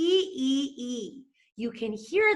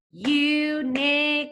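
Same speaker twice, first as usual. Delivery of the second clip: Unicorn slow, higher and louder, beat by beat; drawn-out syllables; 6 semitones higher, 4 dB louder, slower than usual. Shown as a demonstration. Teacher sounding out Unicorn for kids slowly, syllable by syllable. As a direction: stretch Unicorn into its beats. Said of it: "it has its own beat".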